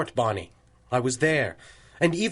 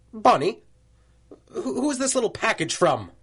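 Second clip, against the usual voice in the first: calling out; 6 semitones higher than usual. Acted. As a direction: shout for her from the next room. Calling out across office.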